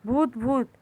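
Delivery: plain reading voice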